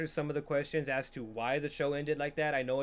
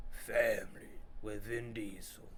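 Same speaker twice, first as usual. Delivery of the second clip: low, slow, soft tough-guy voice; mock Vin Diesel growl; 7 semitones lower, 8 dB quieter than usual. Introducing the next film, low mock-dramatic voice. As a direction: drop it low, slow and quiet.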